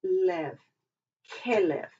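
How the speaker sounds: "Kelev" with the accent at the start; Hebrew word, first syllable stressed. Shown as a demonstration. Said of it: "you always have the accent at the beginning of the word".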